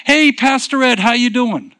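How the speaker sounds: high, loud friendly hail across a crowd; calling out. Retold or imitated. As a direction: call out to him brightly over the crowd. Woman hails him in crowd.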